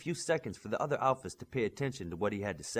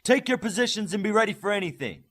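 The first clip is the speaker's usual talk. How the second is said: loud, much higher yelled order; 10 semitones higher, 8 dB louder than usual. A narrator's reading. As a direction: bark the order across the field. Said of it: "he just yelled".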